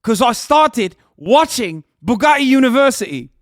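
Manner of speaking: loud, high, swaggering top G voice; puffed-up mock Taliban bro bragging to Ahmed; hammed-up Taliban hustler. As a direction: brag like a wannabe Andrew Tate.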